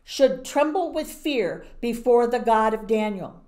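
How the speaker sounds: loud, grand proclamation of a king; king proclaiming God to all his people; royal decree. Acted. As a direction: declare it to the whole kingdom.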